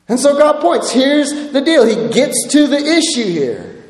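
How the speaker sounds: normal talking voice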